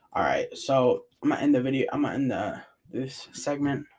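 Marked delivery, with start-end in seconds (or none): none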